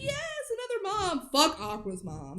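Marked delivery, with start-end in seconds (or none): 0.0-1.3 high, soft squeal of welcome; mock delight. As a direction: imitate thrilled moms meeting, voice pitched way up. Acting moms' delighted greeting.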